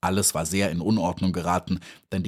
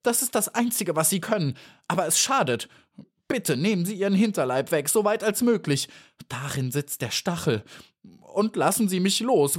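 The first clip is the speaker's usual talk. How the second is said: high-pitched, frantic pleading; frightened fly, pitch way up; panicked wailing; 9 semitones higher than usual. A narrator's reading. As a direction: beg for your life in a squeal. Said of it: "fing ein fürchterliches Geschrei an".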